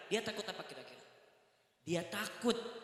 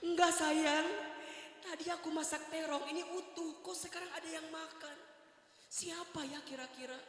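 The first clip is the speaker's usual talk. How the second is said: high wife's voice; 7 semitones higher, 3 dB quieter than usual. Retold or imitated. Wife wondering who ate it.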